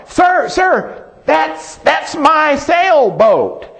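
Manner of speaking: put-on boy telling the storekeeper the boat is his, eagerly excited. A narrator's reading. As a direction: plead to the storekeeper with childlike excitement. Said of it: "he's all excited".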